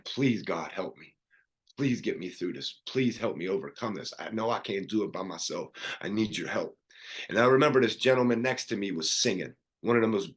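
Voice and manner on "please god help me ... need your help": quiet, pleading murmur to God; retold hushed plea; hushed prayer. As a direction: pray it low and soft, retold.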